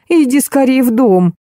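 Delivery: high-pitched call to come in; fussing aunt beckoning, voice lifted; gushing aunt. A narrator's reading. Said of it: "замахала руками дама". (тётушка зовёт высоким голосом)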